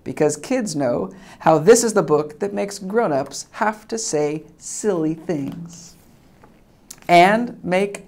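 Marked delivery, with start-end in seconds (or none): none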